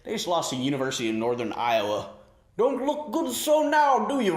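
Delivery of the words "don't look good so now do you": high mock taunt. Taunting Roy over the loss.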